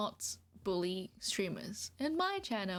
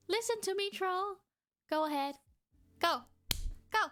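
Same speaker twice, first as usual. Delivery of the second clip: bossy farm master; 4 semitones higher than usual. Pretend. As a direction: boss the troll like a farmhand you drive with a whip. Bossing troll as farm animal.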